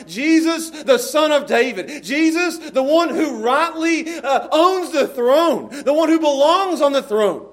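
high, loud, drawn-out acclamation; crying out. Acted. Blind men cry out to Jesus as king, loud proclaiming. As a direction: proclaim it fervently, stretching the big words. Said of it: "and they're crying out".

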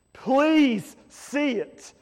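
urgent plea. Real, not acted. Preacher urging the congregation to finally see it, slow and pressing. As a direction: beg it out with rising urgency.

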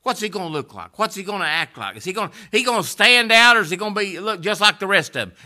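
loud, high, shouted preaching; full-force revival preacher; fiery preaching. Acted. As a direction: preach it hard to the crowd.

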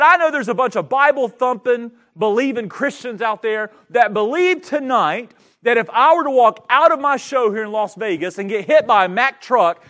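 furious preacher's retelling; retold angry outburst. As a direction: bellow it like the angry magician. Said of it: "he got angry".

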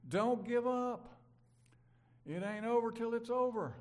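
slow, loud and high, pleading with them not to quit; a preacher's fervent cry of hope; rousing exhortation. Real, not acted. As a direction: lean into every word, urging the flock on.

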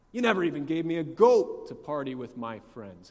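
loud, acted indignant accusation; hammed-up resentful outburst; seething. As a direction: hurl the grievance at the father. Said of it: "you can just hear him seething".

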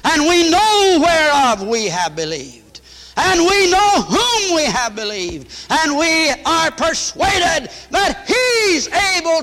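rising revival preacher's cry; full-force preaching. Real, not acted. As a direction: build it up and shout the promise. Preaching builds to climax.

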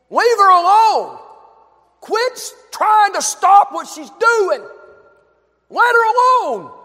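Jesus ordering them to leave her be; firm rebuke. Acted. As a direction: defend her, cut them off hard. Jesus defending the woman, voiced.

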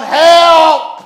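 re-enacted desperate yell; cry for help. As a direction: shout it high, loud and long. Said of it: "I was going".

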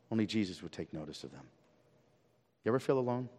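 hushed, low, with long pauses; tender reassurance. Real, not acted. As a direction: speak very softly to comfort someone who feels alone. Preacher turns to comfort the lonely.

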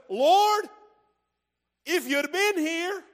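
high, loud, accusing cry of Martha; confrontational Martha. Retold or imitated. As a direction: hurl it at Jesus like a grieving sister. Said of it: "She's a little confrontational".

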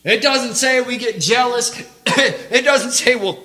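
shouted, high-pitched exhortation; full-force preaching. Real, not acted. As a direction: thunder it at the congregation.

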